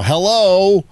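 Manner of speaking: high, loud radio greeting; host phone greeting. Shown as a demonstration. Host shows callers the cue, high loud greeting. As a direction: sing out Hello to the caller. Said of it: "just start doing your impression".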